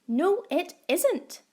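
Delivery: high, loud, slow cross reply. A narrator's reading. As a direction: answer back crossly. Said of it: "even more crossly".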